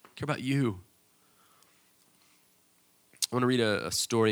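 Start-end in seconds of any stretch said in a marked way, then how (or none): 0.1-0.8 louder, beaming welcome, softening at the end; father welcoming the prodigal son home to a party; joyful father. Retold or imitated. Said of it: "He's like".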